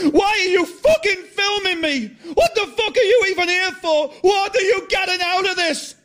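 high, loud, accusing yell; furious shout. Acted. John yelling at Martin for filming him, furious.

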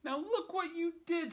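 very high, loud scold; scolding fish. Acted. Fish scolds the cat, very high and loud. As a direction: tell the cat off. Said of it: "said the fish to the cat".

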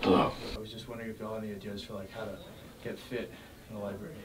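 hushed, fast library whisper. Real, not acted. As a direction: keep it down, fast.

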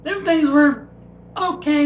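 words around chewing; talking while chewing. Real, not acted. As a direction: mouth partly full of candy. Seen 0: Bit candy at -3; jaw and lip chewing motions continue while talking.

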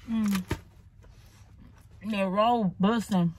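muffled chewing between words; talking while chewing. Real, not acted. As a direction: mouth full of bread. Seen 0: Bites roll at -1, then chews with pursed, closed lips.

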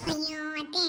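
ordinary talk, nothing marked

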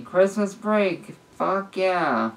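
high, drawn-out mock shout; restrained holiday whoop; celebratory shout. A narrator's reading.

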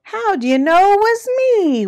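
loud, high-pitched wail; hammed-up turkey wail. A narrator's reading. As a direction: wail it high, stretching the last word. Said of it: "wailed Turkey".

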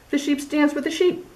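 high, bleaty sheep line; bleating sheep voice. Acted. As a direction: let the sheep bleat the words. Sheep voiced while dancing.

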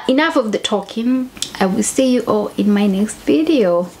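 normal talking voice

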